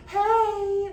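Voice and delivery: high, lighter held call; too-heady call; floating head voice. Shown as a demonstration. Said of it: "there's more power than the head voice".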